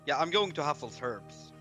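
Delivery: ordinary talk, nothing marked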